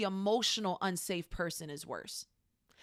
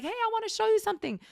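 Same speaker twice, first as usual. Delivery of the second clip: very high, fast, eager announcement; celebrating; 14 semitones higher, 4 dB louder, faster than usual. Acted. You showing a friend your good news, celebrating. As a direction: burst out eagerly to share news. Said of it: "especially in moments of celebration".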